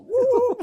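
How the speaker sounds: high, loud "woo"; whooped "woo". Retold or imitated. Retelling a ute on two wheels, whooping. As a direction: let out a long high whoop.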